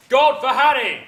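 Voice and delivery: demonstrated battle cry. Shown as a demonstration. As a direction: ring it out like a Shakespearean king. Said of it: "I think they should shout".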